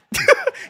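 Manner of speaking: short high-pitched laugh; brief squeal of laughter. Real, not acted. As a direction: burst out in a quick high giggle. Laughs at his own bit.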